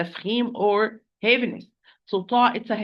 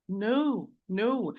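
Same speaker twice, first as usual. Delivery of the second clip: ordinary delivery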